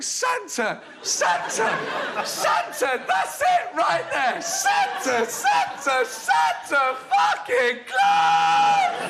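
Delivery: very high mock cries of ecstasy; hammed-up high-pitched passion; girl in bed. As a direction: build to a long, drawn-out climactic wail. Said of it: "I want her to be going".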